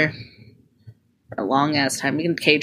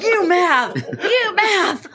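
higher mock-angry echo; mocking mad imitation; 11 semitones higher, much slower than usual. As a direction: parrot his mad retort, teasing. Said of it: "Caleb's so mad".

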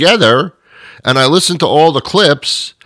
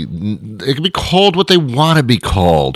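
usual speaking voice

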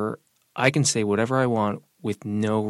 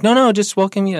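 imitating friendly neighbors, higher and louder; eager reassurance; 10 semitones higher, 8 dB louder than usual. Neighbors reassuring a wary newcomer, eagerly. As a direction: wave off suspicion in a raised, insistent voice.

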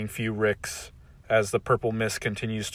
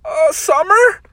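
nervous Morty voice; 9 dB louder, slower than usual. Acted. Morty confused.

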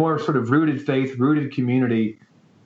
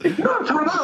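laughing, pitched way up; much faster than usual. Real, not acted. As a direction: laugh through the question. Laughing while explaining strongmen.

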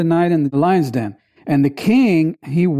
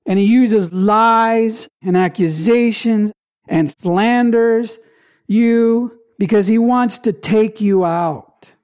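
usual speaking voice

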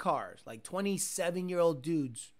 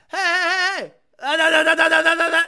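loud high revving noises; imitating engine revving; 8 semitones higher, 12 dB louder than usual. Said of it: "The guy's like".

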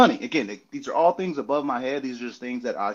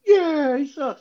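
joining heckler; 7 dB louder, much slower than usual. Retold or imitated. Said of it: "some people gonna be like".

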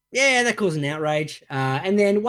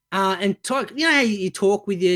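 ordinary delivery

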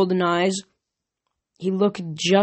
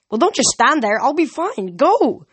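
higher and louder, urging them off; urgent push; 7 semitones higher, 4 dB louder than usual. Acted. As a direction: send them away through the pain. Pinned Thalia urges them on.

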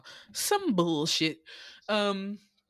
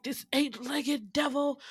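higher, slow, hammed-up dread; mock-terrified puritans; 7 semitones higher, slower than usual. Townsfolk panicking at a spider, mock terror. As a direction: gasp it out like puritans seeing a devil. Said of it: "They were like".

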